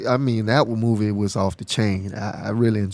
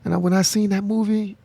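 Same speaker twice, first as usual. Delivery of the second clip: normal talking voice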